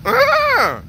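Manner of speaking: high loud bleat; goat bleat imitation. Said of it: "your goat is rusted too".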